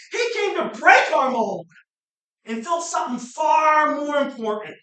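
loud, high preaching, pushing hard; preaching at full force. Real, not acted. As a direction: drive the point home to the crowd.

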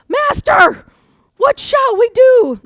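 loud, high, drawn-out shout of alarm; panicked cry. Acted. Preacher shows how the servant really said it, panicked shout. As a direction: yell it out in hammed-up panic. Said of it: "I don't think that's how he said it".

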